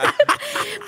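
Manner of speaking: high-pitched squeal of laughter. Real, not acted. Doctor squeals with laughter at her own joke.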